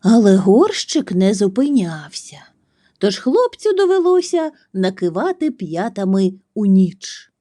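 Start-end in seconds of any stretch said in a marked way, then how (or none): none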